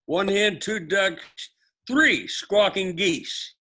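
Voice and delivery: high singsong, slowing at the end; sing song rote recitation. Shown as a demonstration. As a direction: chant the list for them to repeat. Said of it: "Repeat after me".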